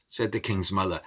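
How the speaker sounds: measured storyteller voice, dramatic rises and hushes; story narration. A narrator's reading. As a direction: tell the tale to a listening crowd. Storyteller narrating a folk tale.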